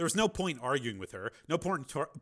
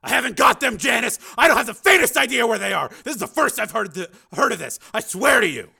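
loud, higher pleading; desperate shout; 12 semitones higher, 16 dB louder than usual. Acted. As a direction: Steele insisting to Janice he doesn't have the kids, loud desperate shout. Steele protesting innocence.

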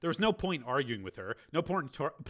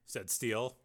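ordinary delivery, nothing marked

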